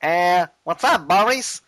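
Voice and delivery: slow, high cartoon rabbit voice; cheeky rabbit drawl rising on the name; Bugs Bunny. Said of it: "the rabbit comes to him".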